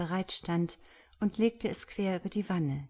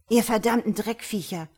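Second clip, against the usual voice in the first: louder, higher furious outburst; angry yell; 4 semitones higher, 6 dB louder than usual. A narrator's reading. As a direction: hurl the insult loudly. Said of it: "brüllte sie". (wütend und laut schimpfend)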